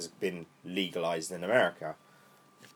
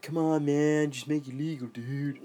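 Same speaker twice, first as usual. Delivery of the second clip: lightly voiced stoner, pitched higher; stoner plea; 6 semitones higher, slower than usual. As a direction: plead like a stoner, lightly put on. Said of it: "it's the old stoner thing".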